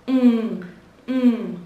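hummed final N sound, drawn out; âm cuối. Shown as a demonstration. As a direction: isolate the nasal ending of "Fan" as a falling hum. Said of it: "Nhớ âm cuối chúng ta". (ngân riêng âm n cuối)

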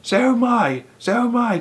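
high, panicky cry; panicked cry. A narrator's reading. Mister Twit cries out in panic to his wife, high-pitched. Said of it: "cried Mister Twit".

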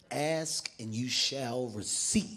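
slow, low mock-solemn line; low, drawn-out pronouncement; ominous aside. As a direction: intone it slowly and low. Cocky aside before the fight.

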